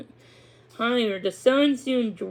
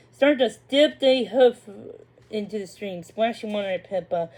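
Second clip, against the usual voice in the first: plain reading voice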